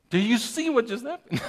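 amazed, high and loud, breaking into laughter; surprised. Retold or imitated. Father retells the rain stopping, surprised and laughing. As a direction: re-enact astonishment with a laugh. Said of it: "I was so surprised".

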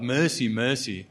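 crying out. Retold or imitated. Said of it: "crying out".

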